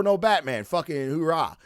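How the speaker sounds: ordinary delivery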